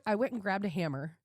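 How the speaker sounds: ordinary talk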